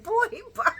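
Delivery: put-on high laughing "boy, bye"; laughing dismissal. Dismissing Sonny while laughing, high and amused. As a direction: brush him off through laughter. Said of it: "I'm like".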